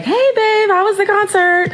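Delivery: put-on high bright boyfriend voice. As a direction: play the cheerful boyfriend.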